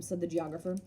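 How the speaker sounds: ordinary delivery, nothing marked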